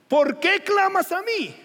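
high, loud and slow rebuke; scolding God. Acted. God scolding Moses for crying out, slow and booming. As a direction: scold him from above. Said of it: "Y el Señor lo regaña".

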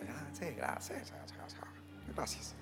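quiet thanks fading to a whisper. Retold or imitated. Bored reply, fading to whisper.

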